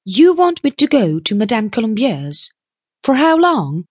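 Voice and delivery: high-pitched puzzled questions; puzzled young woman. A narrator's reading. Puzzled young woman questions the businessman about Paris, high-pitched. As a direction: query it in a high voice.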